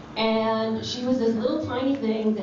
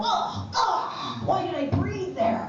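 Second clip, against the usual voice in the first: self-critical whine; 9 semitones higher, slower than usual. Retold or imitated. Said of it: "I go".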